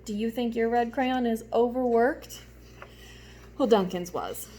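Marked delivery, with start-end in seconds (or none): none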